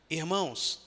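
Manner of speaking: ordinary talk, nothing marked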